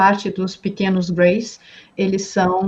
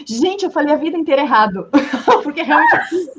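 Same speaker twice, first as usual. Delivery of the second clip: higher voice breaking into laughter; laughing realisation; 8 semitones higher than usual. Retold or imitated. Participant admits saying a word wrong for life, laughing. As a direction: laugh at your own blunder, pitched up. Laughing over own lifelong mistake.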